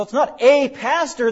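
"a" stressed as a full AY; contrastive stress. Shown as a demonstration. Preacher ruling out the noun, heavy stress on "a". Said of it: "The emphasis is on".

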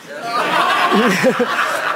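high burst of laughter; a real laugh. Real, not acted. Laughs at own joke.

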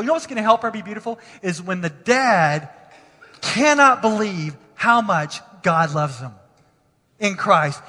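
usual speaking voice

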